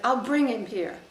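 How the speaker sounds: loud, forceful retold line; retold loud threat. General threatening to fetch the prisoner, loudly. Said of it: "he said".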